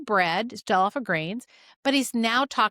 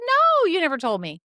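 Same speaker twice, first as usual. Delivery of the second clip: mock exasperation; 8 semitones higher, 6 dB louder than usual. Practitioner telling patient he never mentioned it, playfully exasperated. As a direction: protest in playful disbelief, pitch way up.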